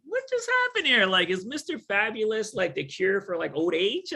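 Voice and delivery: bewildered, high and incredulous; a stunned what-just-happened cry; astonished. Retold or imitated. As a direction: pitch leaps way up in disbelief. Stunned after the old lady's kiss.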